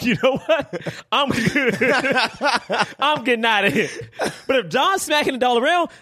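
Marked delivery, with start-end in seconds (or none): 0.0-3.9 higher voice breaking into laughter; laughing bail-out. Acted. Joking to friends about abandoning John, laughing. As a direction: laugh through a mock retreat. Laughing through imagined retreat.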